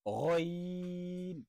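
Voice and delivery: গইন held very long with throat vibration; long held letter. Shown as a demonstration. Teacher showing throat vibration, long held letter. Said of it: "কম্পন তৈরি হবে".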